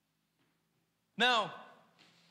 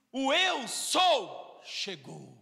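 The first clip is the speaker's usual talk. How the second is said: Jesus's wondrous voice; much slower than usual. Retold or imitated. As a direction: draw it out lovingly, like a gentle revelation. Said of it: "Jesus fala com a voz dele, maravilhosa voz".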